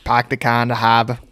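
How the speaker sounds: Boston impression with dropped R's; mock Boston accent. Quoting the Super Bowl car commercial to friends, mock Boston accent. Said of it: "Your accent is the most annoying".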